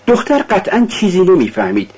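plain reading voice

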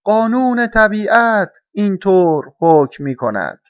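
loud, high, slow proclamation; stern caw. A narrator's reading. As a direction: caw out the rule gravely, word by word. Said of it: "با لحن جدی قارقار کرد".

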